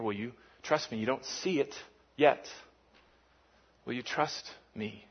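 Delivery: almost hushed, inviting trust; quiet gentle God voice; soft repeated plea. Acted.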